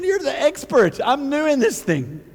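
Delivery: half-joking complaint; mock protest. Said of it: "I'm thinking".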